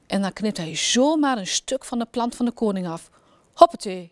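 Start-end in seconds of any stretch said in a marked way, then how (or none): 3.5-4.1 a loud snappy exclamation; brisk and decisive. A narrator's reading. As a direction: snip it off with a loud brisk hoppatee. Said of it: "zegt hij kordaat".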